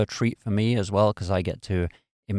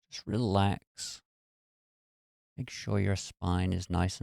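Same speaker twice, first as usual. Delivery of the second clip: guided meditation; 4 dB quieter than usual. Real, not acted. Meditation host talking listeners through releasing muscle tension, guided meditation. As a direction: ease them gently into relaxation, unhurried and even.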